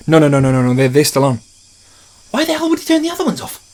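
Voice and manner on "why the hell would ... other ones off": squeaky, fast and exasperated; high squeaky frustrated. Acted. Voiced movie character, frustrated.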